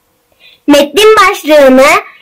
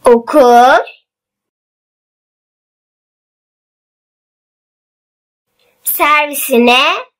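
slow, word by word with long pauses; dictating; slower than usual. Shown as a demonstration. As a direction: read it out slowly for pupils to write down. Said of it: "Ben metni yavaş yavaş okuyacağım".

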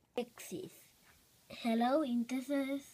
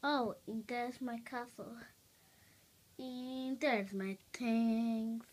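normal talking voice

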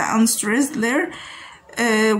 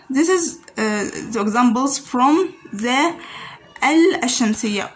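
ordinary delivery

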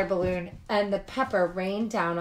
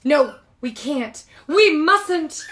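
louder, higher, panicky no; high alarmed protest; 7 semitones higher, 12 dB louder, slower than usual. Acted. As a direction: cry out in alarm. Larry panics at pushing.

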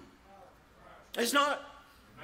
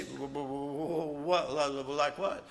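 low, halting stutter of dread; mock terrified stammer; stammering fright; 7 semitones lower, 4 dB quieter than usual. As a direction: stumble over the words in alarm. Stammering fearful reply.

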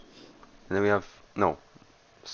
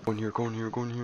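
hushed urging; 2 semitones higher, 6 dB quieter, much faster than usual. Real, not acted. Hiding, urging quietly.

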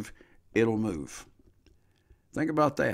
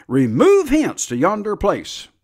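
commanding shout; 8 semitones higher, 9 dB louder, slower than usual. Acted. Preacher commanding the mountain.

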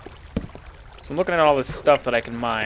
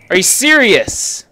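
high loud exasperated cry; can't believe this mess; outraged disbelief; 9 semitones higher, 8 dB louder than usual. Real, not acted. Gamer outraged at the wrecked build, loud disbelief.

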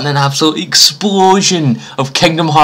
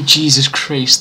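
usual speaking voice

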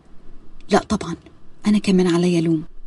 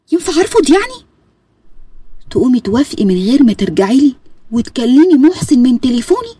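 heated argument; 4 semitones higher than usual. Acted. Lilian and Zein quarrelling over the yacht, heated. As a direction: argue back hard.

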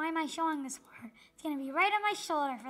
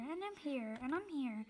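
usual speaking voice

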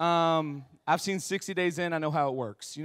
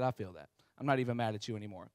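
subdued agreement; 10 semitones lower, 10 dB quieter, faster than usual. Retold or imitated. Makes peace with the cop.

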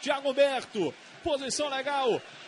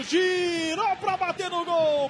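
slow, loud build on the shot; excited; 5 semitones higher, 5 dB louder, much slower than usual. Real, not acted. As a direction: lean into the shot call. Shot on goal, excited call.

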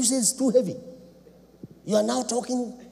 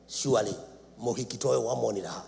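usual speaking voice

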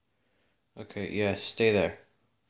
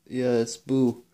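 ordinary talk, nothing marked

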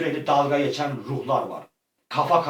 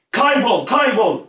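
commanding shout; 6 semitones higher, 4 dB louder, slower than usual. Real, not acted. Casting spell.

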